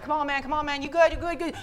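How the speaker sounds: a touch of soothing pleading; calming him down. Retold or imitated. Guys calm Nigel down, lightly pleading. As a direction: talk a buddy down, lightly. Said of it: "some other guys like".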